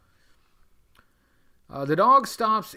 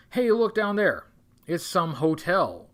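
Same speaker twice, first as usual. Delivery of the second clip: usual speaking voice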